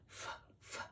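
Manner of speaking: "F, f" as bare voiceless puffs; F sound demo. Shown as a demonstration. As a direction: sound out "F, f" as airy fff, no vowel. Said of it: "They both start with a f".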